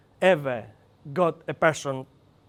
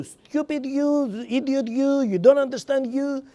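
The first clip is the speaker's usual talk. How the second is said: mocking insult; 7 semitones higher than usual. Shown as a demonstration. Trainer voicing what the question really means to a colleague. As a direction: sneer it out like a put-down. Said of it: "the emphasis is in you".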